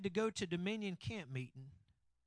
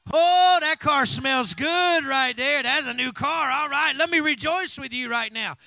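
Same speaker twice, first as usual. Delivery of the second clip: mock rejoicing; 7 semitones higher, 18 dB louder than usual. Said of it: "I gotta go out and I gotta".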